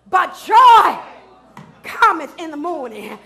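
fervent preacher's cadence, loud and ringing over music; preaching. Acted. Pastor preaching to his congregation, full force.

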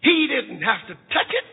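high, impassioned preacher's cry; fiery preaching. Acted. Preacher to congregation, fervent high preaching. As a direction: preach it with a catch in the voice.

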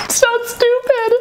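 high giggly laughter; laughing. Real, not acted. Laughing at own joke.

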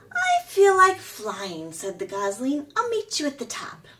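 a gosling bursting to fly; young gosling voice. A narrator's reading. Gosling speaking.

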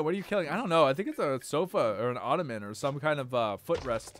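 usual speaking voice

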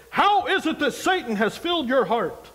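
imitating a churchy friend who would never talk like that; mock friend in Christ. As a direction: play a friend saying it over the top. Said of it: "'cause we don't talk like that".

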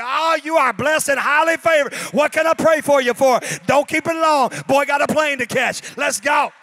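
hammed-up pastor in a hurry; hurried pastor blessing. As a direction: bless her fast, plane to catch. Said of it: "I was like".

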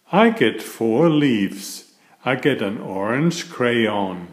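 cheerful bedtime story reading; reading to children. A narrator's reading. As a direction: read it like a picture book to little kids. Reads picture book to children.

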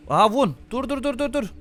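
high, loud urgent yells; panicked loud calling out; shouting stop. Real, not acted. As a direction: yell stop at a fleeing car. Driver escaping the checkpoint.

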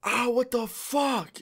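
high, drawn-out imitation scream; high-pitched retold yell of shock; mock scream. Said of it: "he starts screaming".

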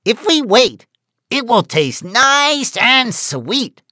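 high, slow goat voice. A narrator's reading. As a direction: coax patiently, high and slow.

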